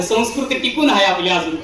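normal talking voice

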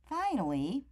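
usual speaking voice

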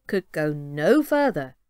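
usual speaking voice

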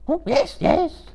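very high, skipping creature voice; eager Gollum. A narrator's reading. Gollum agreeing to lead the hobbits, high eager creature voice. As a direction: bounce it out eagerly. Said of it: "agreed Gollum".

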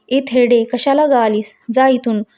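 ordinary delivery

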